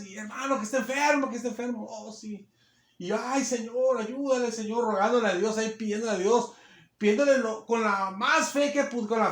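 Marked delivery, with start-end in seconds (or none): none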